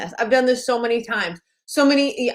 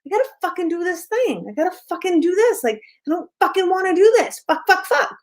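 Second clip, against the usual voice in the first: frustrated grumbling; 8 semitones higher than usual. Retold or imitated. Acting out reluctant self-talk.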